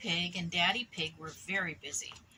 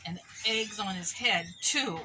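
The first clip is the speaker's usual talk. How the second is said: ordinary delivery, nothing marked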